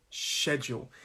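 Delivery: SCHEDULE as "SHED-yool"; "sh" pronunciation. Shown as a demonstration. Teacher models the whole word, SCHEDULE with initial "sh". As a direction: start SCHEDULE with a clear "sh". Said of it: "How do I pronounce this word?".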